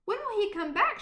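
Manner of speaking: put-on eager child. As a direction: ask like an impatient little girl. Anna pestering again.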